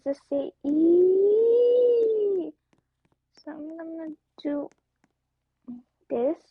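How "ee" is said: long high "ee", swelling loud; high, loud "eee" held long; drawn-out squeal. Acted. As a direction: let out a sustained high-pitched squeal. Acts out a squeal she says.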